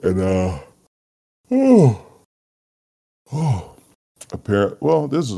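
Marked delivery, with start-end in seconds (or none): 1.5-2.1 long high wailing sigh into a loud oh; dramatic sigh. Real, not acted. Podcaster groaning about starting his own topic, loud high wailing sigh. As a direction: groan out loud like it pains you.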